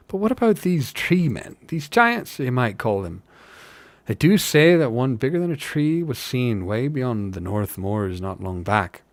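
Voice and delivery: rustic hobbit spinning a rumour, starting high then settling; lilting country-folk gossip; storytelling working hobbit. Acted. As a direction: tell the tall tale as a village fellow.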